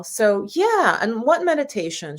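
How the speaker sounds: usual speaking voice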